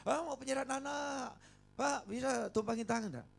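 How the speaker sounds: lightly voiced pushy churchgoer; mock demanding parent. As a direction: imitate an impatient parent. Impatient parents quoted.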